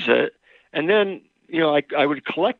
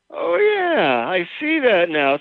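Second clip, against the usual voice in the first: mock grateful admission; 7 semitones higher than usual. Counselor imagining a wife happily admitting fault, mock grateful. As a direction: gush it in a higher, mock-thankful voice. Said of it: "did she respond by saying".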